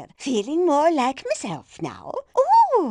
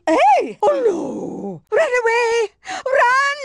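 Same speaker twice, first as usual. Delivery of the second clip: put-on old lady yelling to flee, higher and louder; panicked shout; 8 semitones higher, 5 dB louder, slower than usual. Old lady fleeing danger, panicked yelling. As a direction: cry out in alarm, run away.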